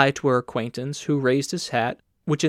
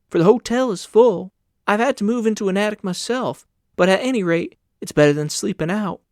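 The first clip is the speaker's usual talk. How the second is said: a hint of a higher, coaxing woman's voice; lightly voiced woman; 6 semitones higher, 3 dB louder than usual. A narrator's reading. Landlady urging Carl to stay, higher and coaxing. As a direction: coax him gently, a bit higher.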